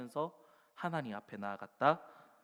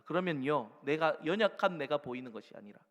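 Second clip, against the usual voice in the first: ordinary talk, nothing marked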